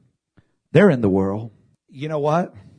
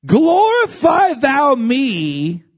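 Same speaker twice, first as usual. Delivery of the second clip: fervent scripture read; 8 semitones higher, much slower than usual. Acted. Reading verse with fervor.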